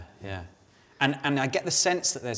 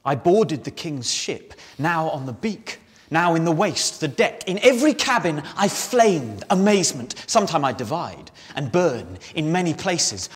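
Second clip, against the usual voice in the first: lines rolling on into each other, swelling at the cabin; tumbling excited verse; 6 semitones higher, 7 dB louder than usual. Acted. Ariel telling of boarding the king's ship, tumbling excitement. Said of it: "looking at that tumble of, of, of the speech".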